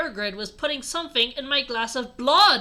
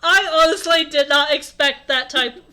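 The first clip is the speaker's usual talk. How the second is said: loud, higher, tearful with laughter; laughing; 4 semitones higher, 10 dB louder than usual. Real, not acted. Reader caught off guard by a typo, laughing. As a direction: talk loudly through real laughter.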